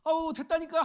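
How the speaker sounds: final exasperated refusal. Retold or imitated. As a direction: shout it and run off. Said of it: "내가 도와줄게". (마지막으로 크게 외치며 사양하는 흉내)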